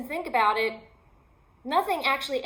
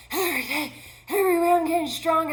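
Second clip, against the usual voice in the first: higher, louder, faster gasping mantra; breathless affirmation; 6 semitones higher, 3 dB louder, faster than usual. Retold or imitated. Hiker panting her mantra on the trail. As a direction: push the words out while hiking, winded. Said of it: "I couldn't breathe".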